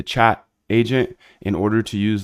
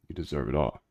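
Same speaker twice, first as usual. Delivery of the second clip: soft reassurance; 4 semitones lower, 7 dB quieter, faster than usual. Real, not acted. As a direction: lower and much softer, sincere. Reassuring viewer softly.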